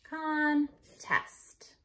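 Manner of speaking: "Contest" drawn out as con, pause, test; split by syllable. Shown as a demonstration. Teacher checking the word, split by syllable. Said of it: "you wanna spell by syllable".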